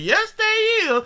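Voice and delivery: high falsetto yes they is; playful high falsetto agreement; mock high agree.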